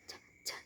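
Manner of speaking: "t" as soft clicks of breath; unvoiced T. Shown as a demonstration. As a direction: tap "t" with no vocal cords. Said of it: "Is unvoiced".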